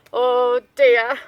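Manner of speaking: a mild sighed oh dear; understated wolf sigh. Acted. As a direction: let it out like a gentle sigh. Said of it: "sighed Wolf".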